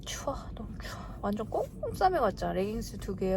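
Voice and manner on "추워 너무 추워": hushed whisper about the cold. Real, not acted. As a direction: breathe the words out. Shivering in the cold at an event.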